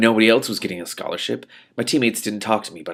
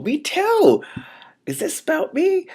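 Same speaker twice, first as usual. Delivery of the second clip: thrilled to relate; high delighted squeak; excited outburst; slower than usual. Real, not acted. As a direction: squeal it, much higher. Reader's excited aside about herself.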